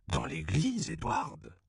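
indignant exclamation, name pitched very high; a shocked priest scolding Edward; exclaiming. A narrator's reading. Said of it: "s'exclama le père Alfonso".

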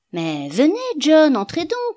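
high, loud welcoming call. A narrator's reading. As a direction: call him in from the doorway. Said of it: "cria-t-il".

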